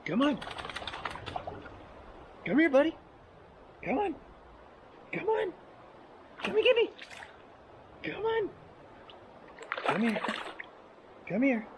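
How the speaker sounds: high sing-song dog call. Real, not acted. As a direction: coax the dog, high and bright. Calling the dog over.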